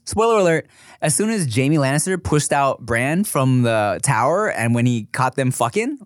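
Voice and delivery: ordinary delivery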